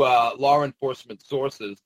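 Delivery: normal talking voice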